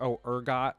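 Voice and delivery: ordinary talk, nothing marked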